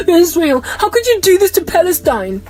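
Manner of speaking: high-pitched mock appeal; mock dismay. Mock outrage at Israel, acted.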